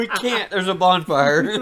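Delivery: high, loud laughing quip. Real, not acted. Cohost joking about too many candles, laughing. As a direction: crack up while joking.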